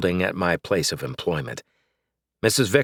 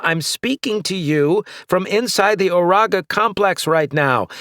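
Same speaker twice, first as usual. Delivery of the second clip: calling out; 10 semitones higher than usual. A narrator's reading. Calling through collar.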